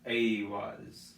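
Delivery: Aiwaz with a long hard A, said slowly. Shown as a demonstration. Teacher demonstrating the rune name slowly, hard A. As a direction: draw out Aiwaz, opening on a hard A. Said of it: "using a hard A pronunciation".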